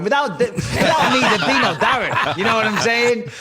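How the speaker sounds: laughing, high and loud mock boast; cocky joke through laughter. Real, not acted. Laughing mock boast about paving the way for Darren. Jokingly boasts, then "only messing.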